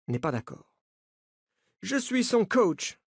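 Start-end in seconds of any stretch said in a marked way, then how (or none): 1.8-3.0 put-on French spoken with an American accent, slow; a coach with a clear American lilt. A narrator's reading. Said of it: "dit-il avec un accent américain". (accent américain, débit lent)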